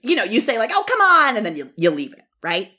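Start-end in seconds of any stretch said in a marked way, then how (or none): 0.7-1.4 loud, high, teasing groan of protest; mock exasperated. Podcaster imitating herself urging a friend, loud mock protest. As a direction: egg on a squeamish friend, much higher and louder. Friend refusing to jump in lake.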